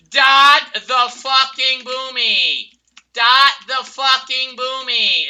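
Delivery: slow, drawn-out furious yell at teammates; angry yell. Real, not acted. Gamer yelling at teammates to dot, slow furious yell. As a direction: bellow the order to dot.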